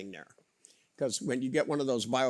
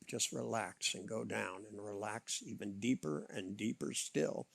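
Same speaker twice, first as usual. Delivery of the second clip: soft spa whisper; 3 semitones lower, 8 dB quieter than usual. Acted. Hypnotic deepening.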